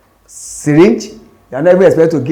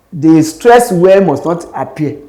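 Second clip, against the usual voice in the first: ordinary talk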